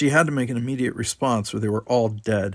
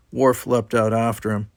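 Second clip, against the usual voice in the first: usual speaking voice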